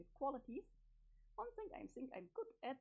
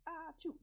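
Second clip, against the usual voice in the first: sneeze; 3 semitones higher than usual. Acted. Sneezes mid thank-you.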